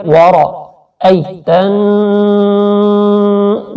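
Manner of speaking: ورأيت with thick ra and الناس with the nun hummed through the nose; heavy ra, nasal nun. Shown as a demonstration. Teacher demonstrating nasal ghunna on الناس, slow and drawn. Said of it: "را کو موٹا کریں".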